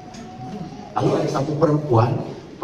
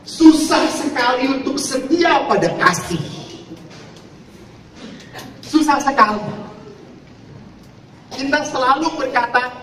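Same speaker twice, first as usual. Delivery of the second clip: preacher's raised cry; emphatic preaching; 12 semitones higher than usual. Real, not acted. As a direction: drive the point home to the congregation. Preacher emphasizing hard truth.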